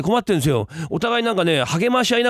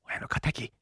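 a hushed prompt, much softer; prompting whisper; 3 semitones lower, 10 dB quieter, much slower than usual. Acted. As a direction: whisper the cue to a partner. Prompting a forgotten stage line. (小声でセリフを教える)

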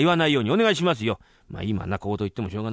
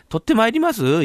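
exasperated scolding; 11 semitones higher, 7 dB louder than usual. Acted. Master scolds apprentice's hopeless offer.

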